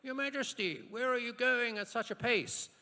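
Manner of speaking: high, loud cry to a king; calling-out peasant. Acted. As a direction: shout it up as a hammed-up peasant. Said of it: "not really a peasant's accent".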